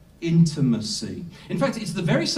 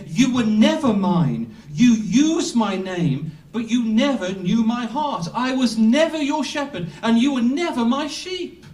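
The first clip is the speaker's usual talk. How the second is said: stern rebuke; 6 semitones higher, 3 dB louder than usual. Acted. Preacher voicing the Lord's rejection.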